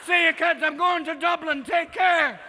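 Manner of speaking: loud, high farewell shout; comic shouted send-off; calling goodbye. Acted. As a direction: holler cheerfully to the kids while leaving. Dancing grandma calls goodbye.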